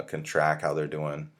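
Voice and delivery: usual speaking voice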